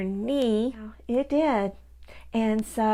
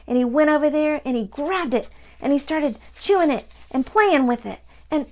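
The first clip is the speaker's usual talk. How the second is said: louder, worked-up puppy retelling; upset puppy; 5 semitones higher, 5 dB louder than usual. Acted. Pocket Puppy telling Grandma how Dobie ripped his heart, louder. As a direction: build it up as the dog rips the heart. Puppet retells the dog chewing.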